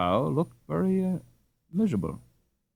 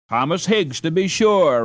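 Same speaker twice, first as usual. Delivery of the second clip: pleased; 6 semitones higher, 6 dB louder than usual. A narrator's reading. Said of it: "pleased to be able to decipher".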